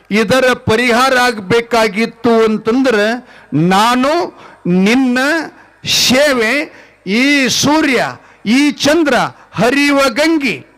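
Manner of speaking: loud, drawn-out sermon plea; fervent plea. Acted. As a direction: preach the devotee's vow at full force. Preaching the saint's plea.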